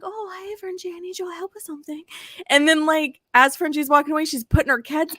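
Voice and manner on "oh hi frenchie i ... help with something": put-on high, soft, sweet girl voice; breezy and sugary, a little fast; high sweet imitation of Sandy; imitating Sandy. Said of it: "She's like".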